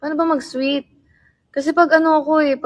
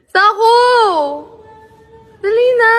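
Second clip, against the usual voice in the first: high, loud call across the room; calling the dog; 10 semitones higher, 10 dB louder than usual. Real, not acted. Calling the dog Tako to come inside, loud and high.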